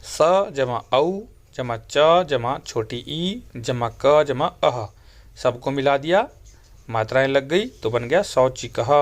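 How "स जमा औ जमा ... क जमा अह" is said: slow letter-by-letter teacher spelling; patient classroom spelling of च plus अ; spelling out slowly. Shown as a demonstration. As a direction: build the word one sound at a time. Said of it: "इसी प्रकार से".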